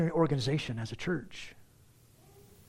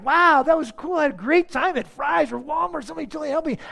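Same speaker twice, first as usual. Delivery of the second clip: much higher, louder, gushing with delight; 10 semitones higher, 5 dB louder than usual. Retold or imitated. Customer raving about the helpful worker, thrilled and high. Delighted shopper praising store.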